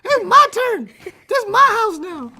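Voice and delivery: put-on high, loud bratty kid; shrill cheeky student taking over; squeaky kid voice. Imitates cocky student taking over.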